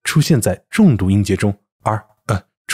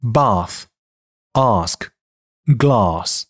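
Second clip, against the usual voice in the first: British bath, ask, glass with long back "ah"; British English demo of bath, ask, glass; British pronunciation; much slower than usual. Shown as a demonstration. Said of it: "英音".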